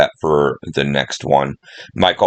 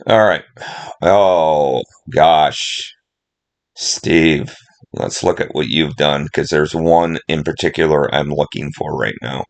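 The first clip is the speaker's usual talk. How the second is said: normal talking voice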